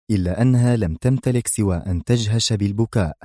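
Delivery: usual speaking voice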